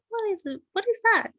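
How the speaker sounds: high, startled questions; surprised child. Acted. Joy suddenly spots something strange. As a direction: gasp it out, amazed.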